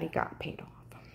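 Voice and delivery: hushed confiding whisper; whispered aside. Real, not acted. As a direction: whisper it like a secret. Confiding rumour.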